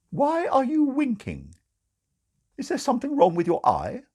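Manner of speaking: high, puzzled question. Acted. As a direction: frown at the winking. Sister Grace puzzled.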